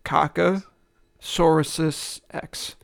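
slow, careful syllable by syllable; sounding it out. Shown as a demonstration. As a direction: spell it out slowly, word by word. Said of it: "I have to sound it out".

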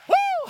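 a high, very loud joyful whoop; ecstatic whoop. Real, not acted. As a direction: let out a wild hallelujah cry.

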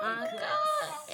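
way up high, drawn-out mock dismay; mock-shocked echo. Host echoing cohost in mock-shocked high squeal. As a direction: squeal it back in fake horror.

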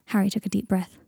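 ordinary delivery

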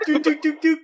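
high, short pipe-drop doots; high staccato synth-like blips; imitated video-game sound. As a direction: mimic a game character dropping down a pipe. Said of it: "does the Mario drop".

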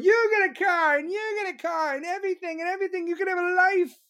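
high, hammed-up Oprah giveaway voice; mock Oprah handing out prizes. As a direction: shout out gifts like a gushing talk-show host. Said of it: "Be like fucking Oprah".

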